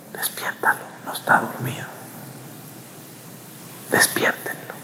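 soft, hushed whispering; urgent whisper. Retold or imitated. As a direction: whisper so the master won't hear. Apostles urging each other secretly.

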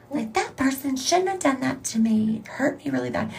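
high, whiny complaint; lightly put-on hurt whine; mock whiny victim. Podcaster mocking her own grudge, high and whiny.

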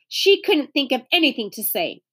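ordinary delivery

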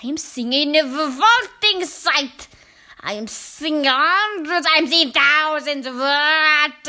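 loud, slow, drawn-out witch rant; screamed witch tirade. A narrator's reading. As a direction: spit out each word in loud disgust. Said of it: "she screamed".

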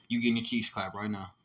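ordinary talk, nothing marked

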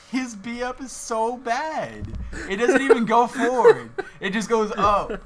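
high, loud, laughing in disbelief; cracking up at how bad it is. Real, not acted. Mocking a character's bad move.

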